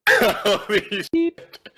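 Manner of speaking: high, drawn-out laughing curse; laughing disbelief. Real, not acted. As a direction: laugh it out in dread. Bad roll dreaded.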